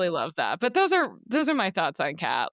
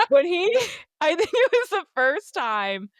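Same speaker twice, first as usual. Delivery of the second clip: laughing; 10 semitones higher, 4 dB louder than usual. Real, not acted. Still laughing after fit.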